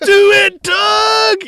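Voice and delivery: loud, high shouted cheer; hammed-up pep-talk yell; mock shout. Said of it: "me and Jamie used to sit and go".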